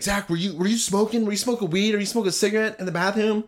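high-pitched imitated mom interrogation; questioning mom. Retold mom interrogating her son.

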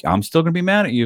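high, fast mock pout; mock petulant coworker. Coworker retelling the angry lead woman, mocking high. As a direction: toss it off in a bratty high voice. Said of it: "she's like".

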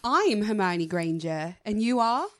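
Hermione Granger. Acted. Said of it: "I'm not good with Hermione Granger".

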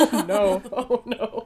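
loud burst of laughter, high; real giggling oh no; laughing. Real, not acted. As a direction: laugh through the oh no. Laughing at the joke.

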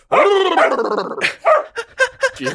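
high loud Gungan wail; mock Gungan boss howl; Boss Nass noise. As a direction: let out a silly high alien cry. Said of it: "when he goes".

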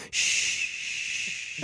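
long hissing shush. Acted. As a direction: shush like a librarian. Quoting the library sign.

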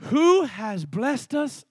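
slow, deliberate scripture reading; unhurried reading aloud; read slowly. Shown as a demonstration. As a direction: let each word land slowly. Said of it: "I want to read this slow".

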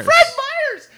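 high, loud comic outburst; mock exclamation. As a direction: yell the store name in mock excitement. Mock exclamation of store name.

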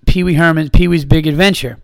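ordinary talk, nothing marked

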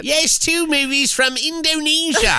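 high, drawn-out mock British; British accent. Host answering co-host's teasing about the voice, British accent. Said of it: "With your British accent".

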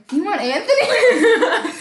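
high, slow, laughing mock question; mocking disbelief. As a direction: scoff it back at him. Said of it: "And I was like".

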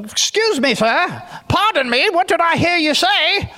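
a light touch of a prim, overly polite gentleman; cultured polite man. Retold or imitated. Gideon answering the angel, lightly voiced prim politeness. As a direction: play Gideon as mild and well-mannered. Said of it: "He sounds like this cultured, really polite guy".

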